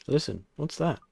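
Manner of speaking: ordinary talk, nothing marked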